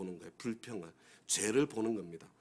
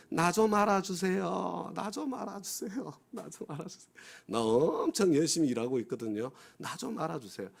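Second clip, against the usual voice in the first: mock complaint from an overlooked church member, whiny and pleading; mock whiny complainer; 8 semitones higher than usual. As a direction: whine like a neglected worker begging to be noticed. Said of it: "어떤 불평이 많은 줄 아세요".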